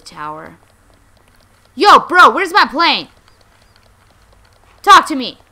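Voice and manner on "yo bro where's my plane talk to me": very loud, high demand; confrontational shout. Pretend. Player confronting a bystander over a lost plane, confrontational shout. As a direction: holler at someone for answers.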